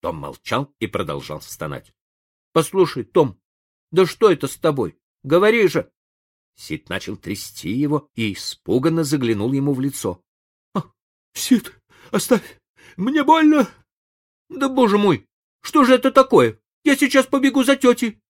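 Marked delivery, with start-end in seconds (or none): 2.5-5.9 higher, louder anxious pleading; scared Sid pleading with Tom; frightened boy. A narrator's reading. 10.7-13.7 high, loud mock-suffering whine; hammed-up pain. A narrator's reading. Tom feigning pain. 14.5-18.2 high, loud frightened cry; panicked boy. A narrator's reading. Sid panicking.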